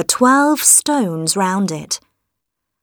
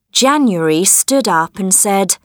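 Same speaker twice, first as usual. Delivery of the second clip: plain reading voice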